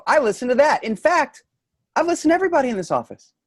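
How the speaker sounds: rising high and fast, fed up; David finally snapping at his boss Frank; exasperated outburst. Acted. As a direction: let the frustration boil over, climbing.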